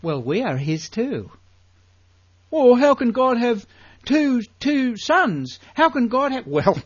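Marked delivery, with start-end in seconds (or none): 2.5-6.5 higher, louder incredulous voice; mock doubter. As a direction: ham up the puzzled objector. Mocks a doubting listener.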